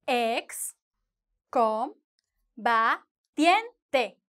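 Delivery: "Ex, com, ba, tiente" with a long pause after each syllable; slow, spaced-out dictation of "Ex, com, ba, tiente". Shown as a demonstration. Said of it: "Five syllables".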